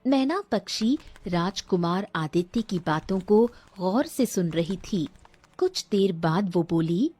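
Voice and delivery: plain reading voice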